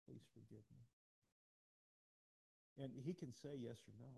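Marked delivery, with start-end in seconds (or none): 0.0-0.8 mild quiet apology. Retold or imitated. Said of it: "you go over and you go".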